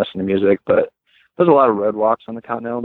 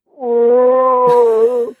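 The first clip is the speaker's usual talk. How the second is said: startled gasp; 13 semitones higher, 4 dB louder than usual. Retold or imitated. Sleep paralysis bear hallucination.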